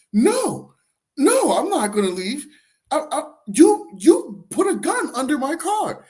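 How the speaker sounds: stretched-out, high-pitched indignation; imitating indignant woman. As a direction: refuse in her high, outraged voice. Retold woman refusing to leave.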